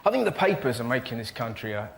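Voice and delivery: ordinary talk, nothing marked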